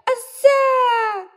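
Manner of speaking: very high, loud, drawn-out shriek; piercing scream. A narrator's reading. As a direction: shriek at a wasp sting. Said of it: "пронзительно закричала Оля". (пронзительный крик)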